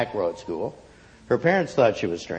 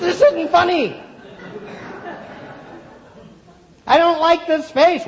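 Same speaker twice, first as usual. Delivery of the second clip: put-on high, loud protesting girl voice; a child complaining loudly; upset girl; 4 dB louder than usual. A narrator's reading.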